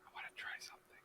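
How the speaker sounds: hushed, secretive whisper; very soft conspiratorial whisper. Acted. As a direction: whisper it to a companion on the sly. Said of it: "whisper to Sapphire".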